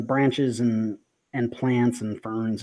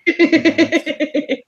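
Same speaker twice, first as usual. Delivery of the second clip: gleeful yes; 10 dB louder than usual. Acted. GM confirms gleefully.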